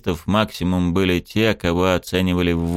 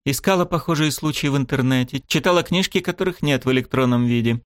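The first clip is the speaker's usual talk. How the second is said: a touch of steel, higher; cold, hard, lightly voiced woman; steely voice; 6 semitones higher than usual. A narrator's reading. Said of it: "Голос Сирены обрёл сталь".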